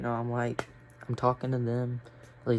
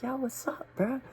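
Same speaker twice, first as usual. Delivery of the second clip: friendly greeting; 13 semitones higher than usual. Retold or imitated. Greeting friend in quiet room.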